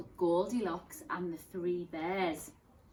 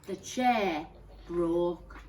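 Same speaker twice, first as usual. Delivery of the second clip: ordinary delivery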